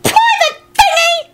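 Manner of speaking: tiny, very high slow screech. A narrator's reading. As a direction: screech the boast in a piping voice. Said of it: "Arthur screeched as he jumped up".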